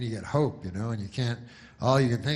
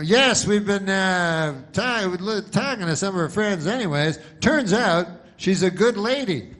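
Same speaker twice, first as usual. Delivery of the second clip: mock reporter; 6 semitones higher, 3 dB louder than usual. Reporter voiced in comedy bit.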